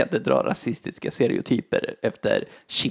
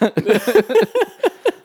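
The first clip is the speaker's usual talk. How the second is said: high burst of laughter; 9 semitones higher than usual. Real, not acted. Laughing at the niqab joke, high laugh. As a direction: crack up high.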